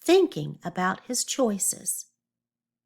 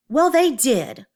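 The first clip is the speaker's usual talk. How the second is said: loud, higher indignant comeback; quick retort; 5 semitones higher, 7 dB louder, slower than usual. A narrator's reading. Oscar talking back to his mom, loud retort. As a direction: fire it back loudly. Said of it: "Oscar quickly replied".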